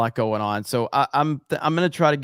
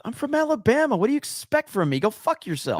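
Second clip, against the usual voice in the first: mock defiance; 11 semitones higher than usual. Jokingly defends his pronunciation.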